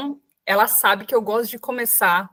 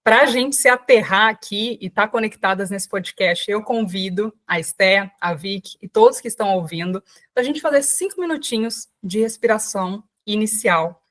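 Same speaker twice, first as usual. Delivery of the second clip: ordinary talk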